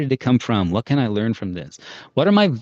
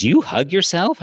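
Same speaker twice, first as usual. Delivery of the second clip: put-on old woman; 8 semitones higher, 4 dB louder, slower than usual. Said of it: "this old woman knocks on my door".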